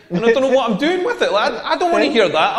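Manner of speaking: ordinary talk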